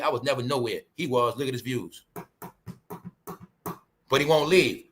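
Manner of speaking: ordinary talk, nothing marked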